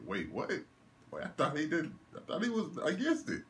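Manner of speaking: high, rising incredulous questions; squeaky outraged confusion; shocked disbelief. Retold or imitated. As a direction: react like you can't believe what you're seeing. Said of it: "I said".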